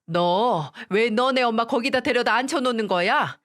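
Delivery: angry mother-in-law demanding answers. A narrator's reading. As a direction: scold down the phone, pitch climbing on the questions. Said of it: "화가 잔뜩 난 목소리로".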